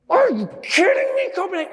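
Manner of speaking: retold furious shout, very high and loud; high, outraged mock tirade; pissed-off yelling. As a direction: yell at the audience in exasperated disbelief. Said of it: "I got so pissed".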